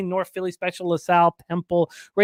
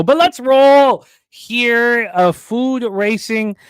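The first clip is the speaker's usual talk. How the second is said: hype call; 6 semitones higher, 7 dB louder, much slower than usual. Acted. Kicking off the race.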